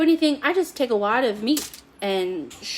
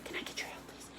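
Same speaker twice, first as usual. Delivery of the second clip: whispered aside; 19 dB quieter, faster than usual. Real, not acted. Aside request to producer.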